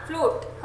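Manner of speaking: फ्लूट soft and brief; फ्लूट without any stretch; wrongly short U. Shown as a demonstration. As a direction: clip the vowel in फ्लूट. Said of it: "हम ऐसा नहीं बोलते".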